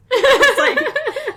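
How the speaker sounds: bursting into a high laugh mid-sentence. Real, not acted. To her co-host, cracking up mid-story with high loud laughter. Laughing while retelling husband's remark.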